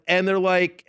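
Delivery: ordinary delivery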